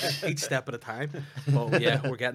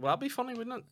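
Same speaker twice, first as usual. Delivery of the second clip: sarcastic reply; 13 semitones higher than usual. Retold or imitated. Retold sarcastic reply to hugger.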